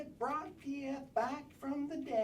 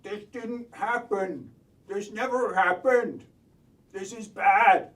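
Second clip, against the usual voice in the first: panicked; 3 semitones lower, 10 dB louder than usual. Acted. Panicked denial building.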